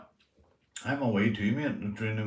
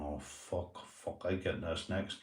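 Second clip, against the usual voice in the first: muttered dread; 3 semitones lower, 11 dB quieter than usual. Retold or imitated. Dreading the ruler, muttering aside.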